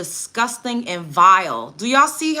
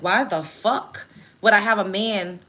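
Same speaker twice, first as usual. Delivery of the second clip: usual speaking voice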